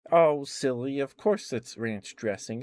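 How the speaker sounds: mock-correct someone for being silly; playful chiding. Dream lady teasingly corrects him.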